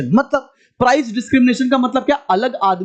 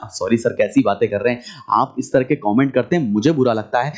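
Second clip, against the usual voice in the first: much lower, subdued student voice; mock offended student; 10 semitones lower than usual. Student objecting to the teacher's teasing comment, mock offended. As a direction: put on a low-voiced complaining student.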